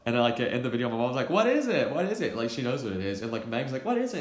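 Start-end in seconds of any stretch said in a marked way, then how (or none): 1.3-2.4 mock mom, higher and louder at first; mom pestering. Mom pestering her son to open the box, eager asking. 3.8-4.2 much higher, mock eager sister; Meg asking. Meg joining the pestering over the box, high eager question.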